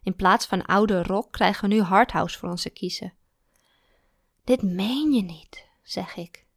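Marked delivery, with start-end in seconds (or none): 4.4-5.7 slow, unvoiced disbelief; a slow whispered groan of disbelief; exasperated whisper. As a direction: breathe it out slowly in dismay. Exasperated at new music.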